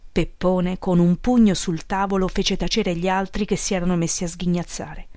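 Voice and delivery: plain reading voice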